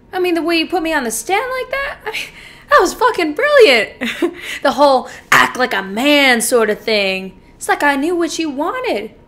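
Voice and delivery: loud, elated praise broken by laughter; exhilarated bragging with cackling laughs; gleeful gloating. Acted. Killer gloats over the courtroom trick.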